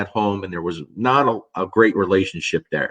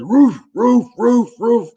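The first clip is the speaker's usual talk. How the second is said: high, loud mock barks from upstairs; imitated dog barking; 13 semitones higher, 5 dB louder, slower than usual. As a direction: bark out "roof" like a worked-up dog. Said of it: "I hear the dog upstairs".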